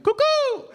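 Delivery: very high, loud bird whistle call; street bird call. Retold or imitated. Guys on the block calling to you, loud bird-call cuckoo. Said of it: "they're like".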